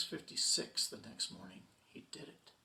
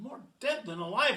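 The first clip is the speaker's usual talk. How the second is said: ordinary delivery, nothing marked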